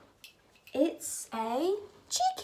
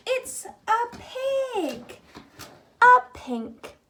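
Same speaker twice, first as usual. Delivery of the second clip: excited; 7 semitones higher, 4 dB louder, slower than usual. Real, not acted. Revealing pig, laughing.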